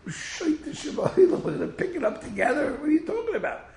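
high squeaky naive voice; high mocking young guy voice. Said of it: "the young guy says".

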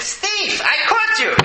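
high, loud mock arrest yell; triumphant shout. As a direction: shout it like a cop nabbing a thief. Acts fake cop grabbing the thief.

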